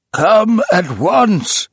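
high, loud, slow cry; cried out. A narrator's reading. Old man summoning his household, high slow loud cry. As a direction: summon everyone urgently, drawn out. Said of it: "he cried".

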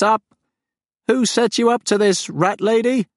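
high, loud alien lament turning bitter; mournful creature. Acted. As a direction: grieve then accuse like a wounded beast. The farm's lament and accusation.

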